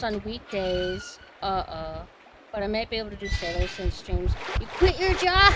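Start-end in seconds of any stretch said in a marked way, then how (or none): 4.6-5.5 loud, high surprised exclaim; excited. Real, not acted. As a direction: burst out in excitement. Excited reaction to chat.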